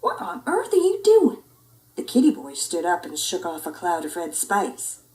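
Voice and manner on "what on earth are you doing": high, loud astonished question; astonished scold. Acted. Mama asking her son, high astonished. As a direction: demand what happened. Mother catches him.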